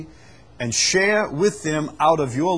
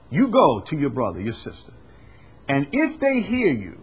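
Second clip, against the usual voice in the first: ordinary talk, nothing marked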